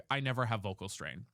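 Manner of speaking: usual speaking voice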